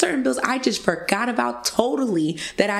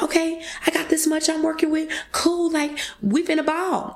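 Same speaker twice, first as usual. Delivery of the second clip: excited; 9 semitones higher than usual. Retold or imitated. Retelling her payday glee.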